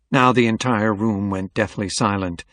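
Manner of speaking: plain reading voice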